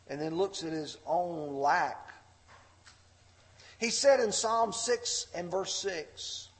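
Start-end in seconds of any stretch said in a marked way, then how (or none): none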